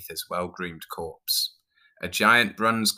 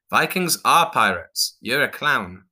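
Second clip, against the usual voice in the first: slow, raised-pitch, louder Viking retort; roaring Viking; 6 semitones higher, 5 dB louder than usual. A narrator's reading. Viking corrects clown, a moderately loud roar. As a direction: declare it forcefully as a Viking. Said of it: "the Viking roared".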